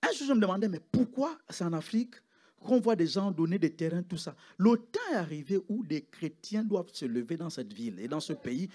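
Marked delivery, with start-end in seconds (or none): none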